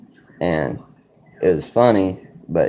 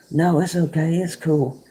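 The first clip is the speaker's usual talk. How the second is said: lightly voiced girl, much higher and soothing; girl's voice; 13 semitones higher than usual. The girlfriend calmly tells the rowdy guys it's fine, in a higher girl's voice. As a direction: go up high to play the sweet girl easing things.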